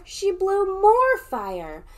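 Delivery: high, slow and loud; alarmed. Acted. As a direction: stress the danger. Alarmed story moment.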